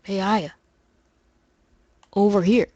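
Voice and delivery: lightly voiced game character, calling over; a hint of a scratchy character voice; Daniel. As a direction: call a partner across, lightly in character. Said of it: "me doing the voice of Daniel".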